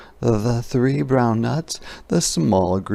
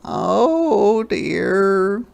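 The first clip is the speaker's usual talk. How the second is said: high, bright little creature wail; high wailing hedgehog voice; 10 semitones higher, 5 dB louder than usual. A narrator's reading. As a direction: wail it as a tiny worried hedgehog. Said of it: "wailed Hedgehog".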